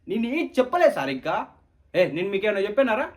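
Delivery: loud, high, fast protest; frantic denial. Acted. Student loudly denies telling anything to the furious sir. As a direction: panic and deny everything. Denying to angry teacher.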